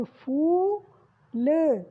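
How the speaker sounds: फूल with a very slow stretched ऊ; long drawn oo. Shown as a demonstration. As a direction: drag out the ऊ in फूल. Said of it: "फा पे ऊ की मात्रा लगी". (फूल में ऊ को खींचकर बोलना)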